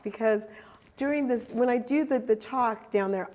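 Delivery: normal talking voice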